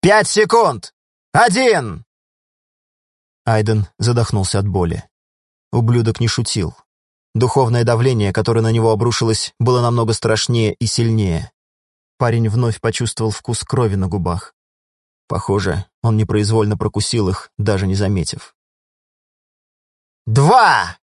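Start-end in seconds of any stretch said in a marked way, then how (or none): 0.0-2.0 loud, high countdown yell; shouted countdown. A narrator's reading. Villain starts a deadly countdown. 20.2-21.0 loud, high countdown yell; shouted countdown. A narrator's reading. Countdown continues.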